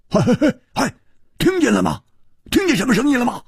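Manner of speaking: high, eager and pressing; anxious urging. A narrator's reading. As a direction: hurry him for an answer. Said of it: "蝴蝶花急着说". (急切地高声追问)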